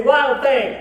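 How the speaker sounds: loud call to the crowd. Shown as a demonstration. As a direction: shout it out for them to repeat. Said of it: "Everybody say".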